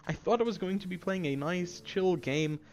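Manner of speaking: normal talking voice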